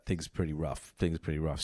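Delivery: sick, nasal and low; blocked nose from a cold. Real, not acted. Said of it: "sorry for the nasal sounding voice".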